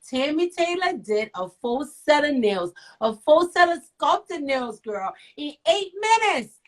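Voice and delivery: amazed. Real, not acted. Amazed retelling of fast nails.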